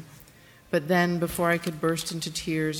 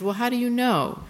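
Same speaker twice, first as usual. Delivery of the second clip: higher, louder, insistent question; pushy disbelief; 8 semitones higher, 5 dB louder than usual. Retold or imitated. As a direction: press the nurse for proof. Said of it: "So I pushed".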